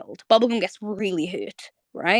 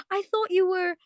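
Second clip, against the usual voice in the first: character voice; 11 semitones higher than usual. Retold or imitated.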